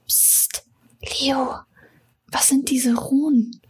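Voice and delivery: hushed secretive whisper; slow, barely voiced question. Secret question about the runes. (heimliches Flüstern)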